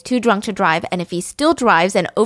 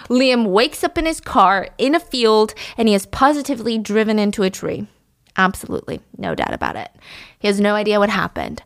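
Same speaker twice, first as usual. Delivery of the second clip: ordinary delivery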